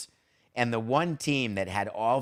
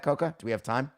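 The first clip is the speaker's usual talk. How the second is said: ordinary talk, nothing marked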